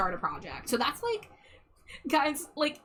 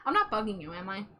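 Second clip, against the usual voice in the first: normal talking voice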